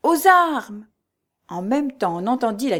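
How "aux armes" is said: loud, high call to arms; ringing cry. A narrator's reading. Sentry on the ramparts raises alarm, ringing cry. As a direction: shout the alarm for all to hear. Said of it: "retentit ce cri".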